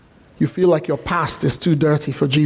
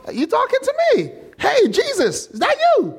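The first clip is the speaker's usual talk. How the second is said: very high, puzzled questioning; comic disbelief. Acted. Lazarus in the tomb asking Jesus, comic high disbelief. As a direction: call out high, as if unsure who's there.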